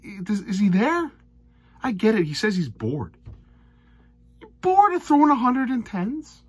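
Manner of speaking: loud, high, exasperated rant at the player; fed-up darts fan ranting loudly. Real, not acted. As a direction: vent in disbelief, almost yelling. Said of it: "Sorry for my rants".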